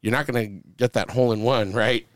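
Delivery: normal talking voice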